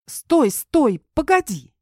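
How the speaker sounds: high, loud alarmed plea. A narrator's reading. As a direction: stop him in a panic. Startled girl wards him off.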